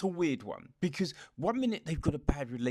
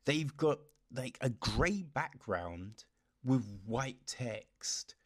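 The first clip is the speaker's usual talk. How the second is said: usual speaking voice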